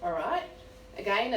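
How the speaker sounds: ordinary delivery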